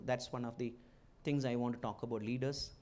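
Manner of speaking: ordinary delivery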